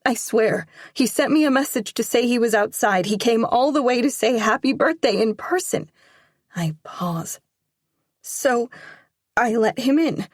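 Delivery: put-on nervous teenage daughter explaining fast; anxious daughter. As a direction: plead your case to your father. Teen daughter pleading her case.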